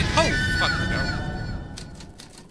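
loud high-pitched yell of real fright; jump-scare outburst, high and loud; startled shout. Real, not acted. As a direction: shout it out in sudden shock.

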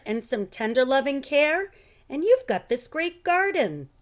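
put-on eager kid showing off his garden; excited. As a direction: boast about the garden, voice climbing. Carlos excited about his garden.

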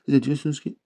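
ordinary talk

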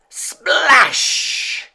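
loud high sound-effect splash; excited splash. A narrator's reading. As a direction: burst out with the splash. Climax of animal chain.

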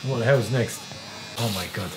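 ordinary talk, nothing marked

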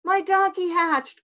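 high, loud joyful cry; excited cry. Acted. Bear thinks his donkey hatched, crying out. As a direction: cry out with delight. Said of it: "cried Bear".

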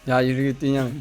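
usual speaking voice

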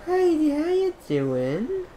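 high, slow, drawn-out greeting; playful cooing greeting. Acted. Player greeting a buddy in the game, high drawn-out coo.

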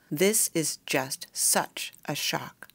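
slow, raw, stunned delivery. A narrator's reading. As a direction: drag the words out of a raw throat. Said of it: "her voice was raw".

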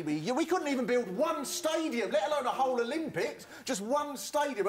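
high-pitched incredulous complaint; exasperated rant. Acted. Londoner despairing over the Olympic stadium, exasperated rant. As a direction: climb high in comic exasperation. Comic rant about English incompetence.

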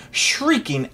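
plain reading voice

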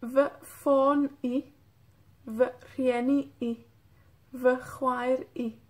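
"V fon I", "V rieni I" and "V juair I" said slowly with a trailing I; slow demo with final I. Shown as a demonstration. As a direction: drag each word out, add the I. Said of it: "pueden escuchar también". (pronunciación lenta con la I final)

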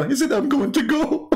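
high voice, squeezed by laughter; laughing retelling. Real, not acted. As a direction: retell it while cracking up. Laughing while retelling caller's exit.

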